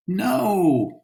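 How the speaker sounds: high cry of dismay; dismayed shout. Acted. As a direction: groan out a no at the bad play. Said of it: "the women shouted".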